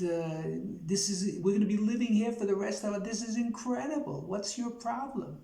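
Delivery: wife pleading with her sour husband in the afterlife, pitched high; wife's voice. Acted. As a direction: play the baffled wife, voice pitched well up and pleading. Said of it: "she turns to Fred and says".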